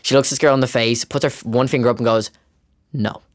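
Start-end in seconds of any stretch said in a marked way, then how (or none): 2.9-3.2 quiet, controlled retold refusal; softly final, acting out the fiancée; imitated firm no. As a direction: imitate a quiet one-word put-down. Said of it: "I don't know what tone she used".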